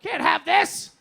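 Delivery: put-on high, loud, drawn-out drunken shouting; hammed-up jealous bellowing; drunk yelling. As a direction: yell it like an indignant drunk. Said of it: "But I'm drunk, I'm yelling".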